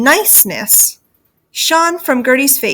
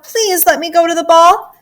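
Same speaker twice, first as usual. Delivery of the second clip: high, insistent begging; child's desperate pleading; wailing plea; 7 semitones higher, 4 dB louder than usual. Acted. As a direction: beg in a raised, wailing voice. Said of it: "Gertie wailed".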